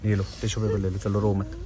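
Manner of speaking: low, hushed comforting; gently calm someone who is crying, voice dropped low; soft, deep reassurance; soothing. Real, not acted. Son soothes his crying mother.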